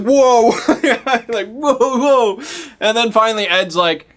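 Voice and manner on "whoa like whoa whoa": high, loud laughing whoa; alarmed whoa. Retold or imitated. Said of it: "they're all just like".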